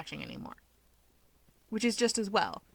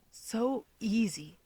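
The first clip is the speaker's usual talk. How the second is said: disparaging; 3 semitones higher, 4 dB quieter, much slower than usual. A narrator's reading. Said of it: "Toya thinks disparagingly".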